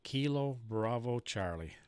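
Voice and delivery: ordinary talk, nothing marked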